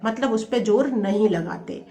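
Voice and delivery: usual speaking voice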